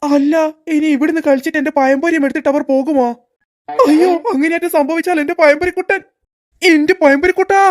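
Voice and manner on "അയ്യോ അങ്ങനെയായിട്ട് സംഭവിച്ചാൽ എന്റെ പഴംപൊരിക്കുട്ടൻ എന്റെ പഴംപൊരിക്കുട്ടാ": put-on guard dreads children stealing his fritter, rising panicked wail. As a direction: wail as if losing a treasure. Guard fears kids take his snack.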